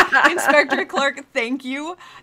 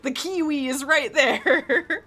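slow, drawn-out mock scolding; mock exasperation; slower than usual. Editor scolding losers from the past, mock exasperation. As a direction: drag out the words in hammed-up frustration.

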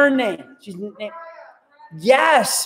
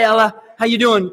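normal talking voice

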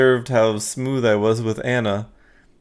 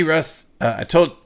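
normal talking voice

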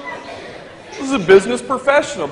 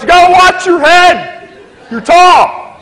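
high, loud warning call; bossy loud heads-up; 9 semitones higher, 7 dB louder, slower than usual. Retold or imitated. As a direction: flight attendant calling out a loud warning to mind your head. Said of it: "She goes".

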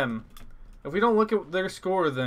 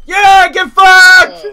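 slow, very loud high roar; triumphant yell; 19 dB louder, much slower than usual. Real, not acted. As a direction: scream it in victory. Delivers flag, triumphant yell.

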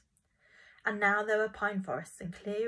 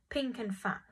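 ordinary delivery

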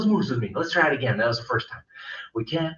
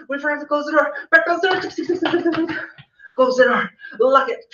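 higher, breathless rushing retreat; hurried mock panic; 8 semitones higher, faster than usual. Teacher racing the kids back home, higher and hurried. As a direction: rush back home in a playful fright.

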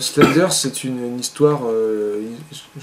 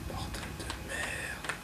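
whispered curse; 21 dB quieter, much slower than usual. Real, not acted. Scared, creeping in the dark.

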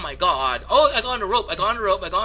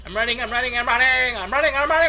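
climbing to a high, loud frantic squeal; hammed-up, breathless repeated wailing that keeps building; annoying YouTuber panic; 9 semitones higher, 9 dB louder, faster than usual. Player fleeing the cops in game, annoying over-excited YouTuber panic. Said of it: "me being annoying".

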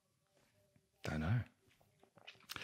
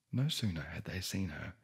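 ordinary delivery

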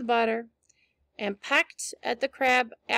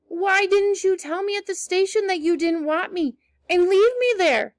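reproachful; 6 semitones higher, 4 dB louder than usual. A narrator's reading. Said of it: "she added reproachfully".

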